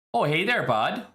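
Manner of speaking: put-on Canadian hockey accent. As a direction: roll in like a Canadian hockey fan, eh. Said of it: "the hockey accent".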